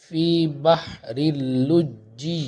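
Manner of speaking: اللجی with doubled ج, slow chanted, then لجی held long; tajweed demo. Shown as a demonstration. Teacher drills the shadda in لجی for students, slow chant. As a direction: stress the shadda on ج in لجی, drawn out. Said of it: "لام جیم پیش لج".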